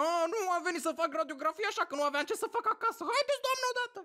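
fast, high mock exasperation; irritated sarcasm. Patient in pain sniping at the radiographer, exasperated sarcasm. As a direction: rattle it off sarcastically, pitch climbing.

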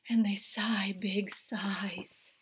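slow, whispered relief; soft sigh of relief. A narrator's reading. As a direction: breathe it out slowly. Relief told softly.

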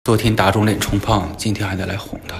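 very low, soft self-talk; resigned inner aside. Acted. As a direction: mutter it to yourself, low and reluctant. Inner aside before knocking.

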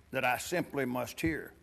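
just a hint of the scheming Grinch; soft, low storybook Grinch. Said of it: "Let me get my Grinch voice in".

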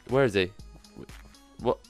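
ordinary talk

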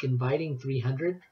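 ordinary delivery

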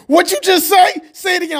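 bully demanding he repeat it; angry shout. Acted. A bully confronts the speaker.

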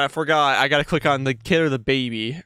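ordinary talk, nothing marked

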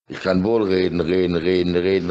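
ordinary talk

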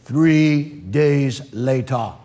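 very slow, low announcer voice; mock narrator caption. Narrator marking a time jump, slow low mock-dramatic voice. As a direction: draw out each word, deep and deliberate.

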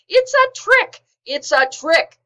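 high, loud panicked yells of a furious crowd; shrill frantic animal mob shouting; angry mob yelling. A narrator's reading. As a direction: scream the alarm like a stampeding mob. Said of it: "all yelling".

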